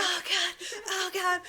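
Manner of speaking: high, hammed-up fretting; mock nervous panic. Suspect under police questioning, mock-nervous fretting. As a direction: fret like a flustered suspect, playfully. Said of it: "She's a nervous chick".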